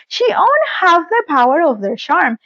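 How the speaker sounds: usual speaking voice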